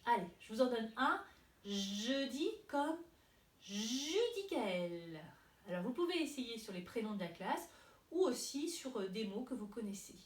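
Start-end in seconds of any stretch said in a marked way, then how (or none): 1.6-5.2 long "zh" opening "Jeudi" and "Judicaël"; stretched first sound. Shown as a demonstration. Teacher gives an example word, "Jeudi" and "Judicaël" with long "zh". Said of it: "des mots qui chantent comme jeudi".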